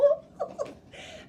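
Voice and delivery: high breathless giggle; nervous giggle. Real, not acted. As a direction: giggle in spite of yourself. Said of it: "it made me giggle".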